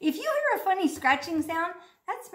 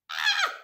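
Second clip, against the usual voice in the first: high gasp after swallowing water; gasp, sharp and high; gasp after gulp. Real, not acted. Gasps after gulping.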